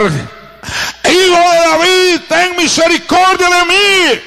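crying out. Retold or imitated. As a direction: call out like a blind beggar begging Jesus. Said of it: "comienza a gritar".

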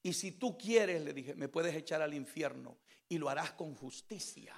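low, very soft, subdued testimony; testimonio íntimo. Retold or imitated. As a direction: recount a private prayer in a hushed, low voice. Subdued testimony of talking with God. (testimonio en voz baja, grave y recogida)

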